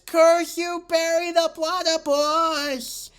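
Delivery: very high, drawn-out cartoon shout; villain's cursing cry. Villain cursing Perry after getting hit, high drawn-out yell. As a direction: wail the curse out high and long.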